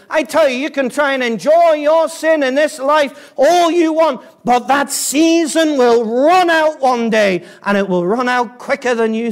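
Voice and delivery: high, loud pulpit warning; forceful hellfire preacher; impassioned preaching. Real, not acted. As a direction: warn the congregation urgently at full voice. Warns sinners that time runs out.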